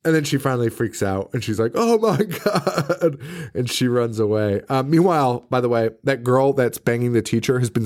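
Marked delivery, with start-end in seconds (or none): 1.8-3.2 high, slow, laugh-broken gasp; hammed-up horror; mock freakout. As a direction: drag out a shocked cry. Courtney finally freaks out.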